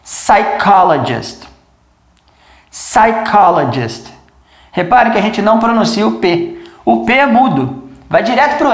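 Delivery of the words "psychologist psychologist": Psychologist slow, starting on s; silent P. Shown as a demonstration. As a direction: psychologist with no P sound. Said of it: "olha só".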